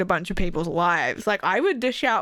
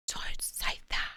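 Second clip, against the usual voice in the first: hushed mock scolding whisper; playful whispered telling-off; 12 dB quieter than usual. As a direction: whisper it like a secret warning.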